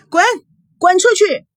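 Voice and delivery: high, loud banishing shout; furious yell. A narrator's reading. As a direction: drive him out with your voice. Daughter screaming at visitor to leave.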